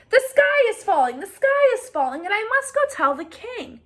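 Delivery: high, loud frantic hen; panicked cry. Acted. Henny Penny frantically warns Ducky Lucky, high and loud. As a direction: flap and shout the news.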